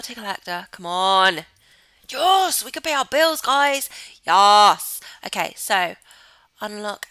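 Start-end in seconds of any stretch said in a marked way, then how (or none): 2.1-5.0 loud, high shout of victory; triumphant cheer. Real, not acted. Gamer finally able to pay bills, triumphant cheer. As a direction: cheer it out to the whole audience.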